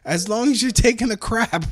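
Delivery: playful, high-pitched punchline; retold joking reply. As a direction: lift the voice high for a jokey retort. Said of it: "I'm like, "Well".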